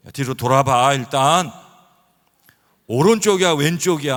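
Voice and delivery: loud, high barked questions; brusque orders. Retold or imitated. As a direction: order her around. (크고 높게 다그치기)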